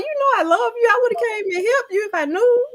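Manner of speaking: very high, exaggerated reassurance; high-pitched protest. Grandmother retells answering her upset grandbaby, high-pitched protest.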